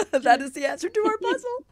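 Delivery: very high, loud squealing laugh and words; delighted laughing squeal. Real, not acted. Announcing the answer to everyone, delighted squeal. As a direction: squeal it out with joy.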